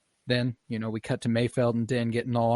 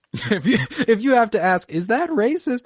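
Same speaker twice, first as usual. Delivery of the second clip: high and louder through laughter; laughing; 11 semitones higher, 6 dB louder than usual. Real, not acted. Podcaster echoing the joke, laughing high and loud. Laughing reply to racist question.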